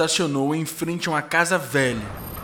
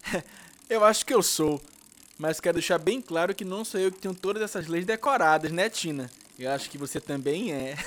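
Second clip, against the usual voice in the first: put-on high, fast young boy voice; chatty kid explaining; 3 semitones higher, 4 dB quieter, faster than usual. Guga answers laughing.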